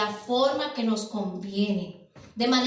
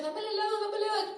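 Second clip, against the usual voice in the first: put-on higher, whining child voice; demanding child; 8 semitones higher, 3 dB quieter, much faster than usual. Preacher voices a child nagging his cooking parent, higher and whiny. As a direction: nag for the ice cream like a kid. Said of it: "el muchacho".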